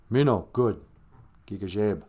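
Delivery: usual speaking voice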